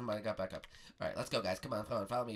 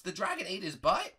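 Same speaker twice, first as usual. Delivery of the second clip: put-on high boyish disbelief, rising end; shocked question; 10 semitones higher, 7 dB louder than usual. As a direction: repeat it as an astonished question. Bart is shocked by Prego's tale.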